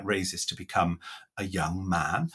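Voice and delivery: ordinary talk, nothing marked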